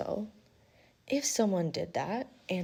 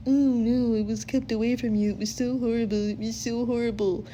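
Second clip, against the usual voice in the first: higher, louder whining excuse; mock whiny husband; 4 semitones higher, 6 dB louder than usual. Mocking the husband's excuses to his wife, high whiny whine. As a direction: whine like a cheating husband faking misery. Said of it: "that man comes running back going".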